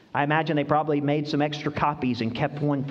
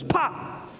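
imitated pop; 13 semitones higher than usual. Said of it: "he goes".

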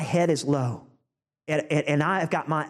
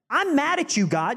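mock angry, pitched higher; angry Jonah; 7 semitones higher than usual. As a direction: voice Jonah's sulky anger at God, pitched up. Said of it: "He was mad".

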